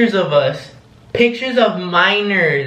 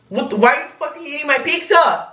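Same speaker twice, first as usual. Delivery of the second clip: retold indignant outburst; 8 semitones higher, faster than usual. To Michael who ate the whole pizza, mock indignant outburst. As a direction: squeak out imitated indignant disbelief.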